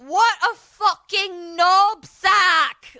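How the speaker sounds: loud, slow, high-pitched insult; exasperated, drawn-out jeer. Retold or imitated. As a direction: drag out each word at full volume. Retold insult, acted out loudly.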